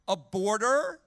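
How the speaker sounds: ordinary talk, nothing marked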